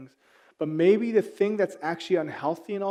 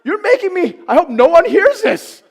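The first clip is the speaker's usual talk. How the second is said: high, loud exaggerated fake anger; dad pretending to scold, louder and higher; mock outraged dad; 9 semitones higher, 15 dB louder than usual. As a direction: ham up the brutal dad. Acting out exasperated dad.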